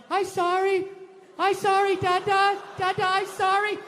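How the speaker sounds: put-on high, pleading little girl voice; crying toddler. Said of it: "with big tears in her eyes".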